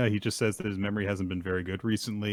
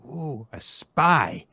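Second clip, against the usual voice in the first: a hint of an intrigued child, higher and drawn out; slow, raised-pitch ooh of discovery; light, slow kid-detective voice; lightly voiced Anya; 7 semitones higher, 6 dB louder, much slower than usual. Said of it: "Anna hears all this and thinks to herself".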